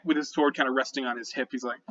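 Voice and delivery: ordinary talk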